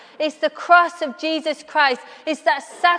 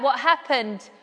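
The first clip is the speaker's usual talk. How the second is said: ordinary talk, nothing marked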